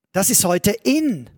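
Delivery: usual speaking voice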